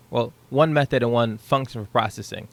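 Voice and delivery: ordinary talk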